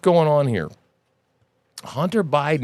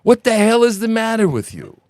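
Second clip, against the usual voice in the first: pretend angry father; 9 semitones higher than usual. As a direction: play the fed-up dad chewing out his son. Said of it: "Can you imagine that conversation?".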